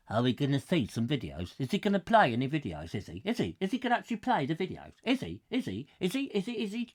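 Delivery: small, soft pestering voice; impatient viewers. Retold or imitated. Host mimicking viewers waiting with popcorn for videos, impatient soft nagging. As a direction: mimic an audience nagging on repeat. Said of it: "I know you're looking there impatient".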